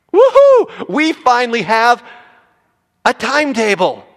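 very high, loud whoop of joy; cheering. Acted. Abraham celebrating the promised year, loud high whoop. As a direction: whoop like you just got the news.

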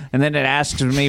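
usual speaking voice

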